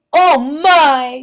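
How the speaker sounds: loud high fake gasp of dismay; mock dismay. Martina faking dismay after spilling coffee on rooster. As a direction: pretend to be shocked at your own clumsiness. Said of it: "she said with mock dismay".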